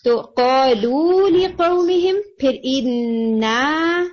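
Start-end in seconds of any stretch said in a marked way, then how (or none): 0.2-2.2 قَالُوا لِقَوْمِهِمْ recited slowly with long vowels, higher pitch; recitation demo. Shown as a demonstration. Teacher re-reciting the verse for the student, slow and drawn out. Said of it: "یہ والا الف مدیہ بھی آپ کا لمبا ہوگا". 2.6-4.0 اِنَّا with the final alif held very long; long alif demo. Shown as a demonstration. Teacher showing the long alif in اِنَّا, drawn out. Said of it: "یہ والا الف مدیہ بھی آپ کا لمبا ہوگا".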